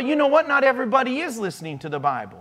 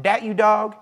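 usual speaking voice

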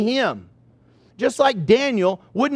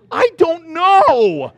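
slow, higher, louder exasperated wail; answering the pastor, exasperated; helpless I don't know; 8 semitones higher, 7 dB louder, much slower than usual. Retold or imitated. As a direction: drag it out in helpless despair. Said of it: "And I go".

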